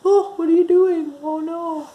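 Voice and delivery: high, fretful pup; the dog Max's high, anxious fretting; worried dog voice. Acted.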